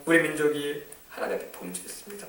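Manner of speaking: quiet, humble confession. Shown as a demonstration. Preacher modelling a repentant prayer, quietly. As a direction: murmur it to God. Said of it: "이렇게 기도를 하는 겁니다".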